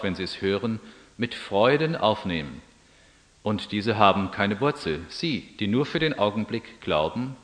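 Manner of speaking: measured, solemn Bible reading; scripture reading. Acted. Pastor reading the Gospel explanation, solemn and measured. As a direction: read the parable's meaning aloud.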